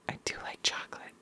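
quick whispered confession. Real, not acted. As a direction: whisper it like a secret. Confiding aside.

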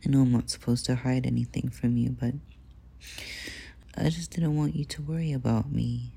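ordinary delivery

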